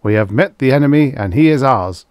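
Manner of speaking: loud, high military recitation; chorused report. A narrator's reading. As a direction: report in unison like drilled soldiers. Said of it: "they chorused".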